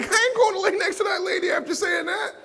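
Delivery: high, loud, laughing through it; laughing shout. Retold or imitated. Speaker joking to the crowd about not sleeping beside his wife, laughing. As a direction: shout it while cracking up. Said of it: "I was like".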